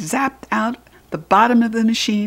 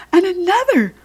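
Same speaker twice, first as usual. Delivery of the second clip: much higher final another; rising emphasis; 10 semitones higher than usual. A narrator's reading. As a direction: climb up on the last another. Rising count of toilets.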